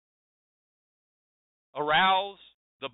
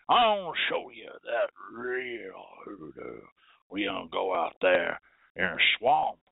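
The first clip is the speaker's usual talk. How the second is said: put-on Southern country accent; 6 semitones lower than usual. Lecturer mimics a folksy country conjurer luring a visitor, thick Southern accent. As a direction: lay on a thick rural Southern accent to sell the mystique. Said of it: "have an accent like that".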